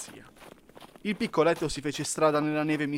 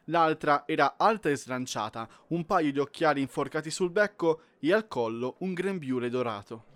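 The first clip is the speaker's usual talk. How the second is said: usual speaking voice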